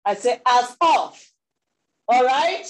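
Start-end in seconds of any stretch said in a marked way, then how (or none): 0.5-1.3 "As of" punched loud, set apart; stress on "As of". Shown as a demonstration. Preacher correcting what was said, stressing "As of". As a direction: hit "As of" much louder than the rest. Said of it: "I didn't say".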